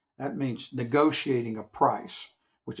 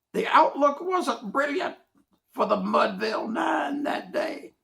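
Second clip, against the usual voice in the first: higher, slow recitation voice; bird reciting a poem; 10 semitones higher than usual. Acted. Bird reciting Casey at the Bat. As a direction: declaim it like a grand poem. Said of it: "began to recite that famous baseball poem".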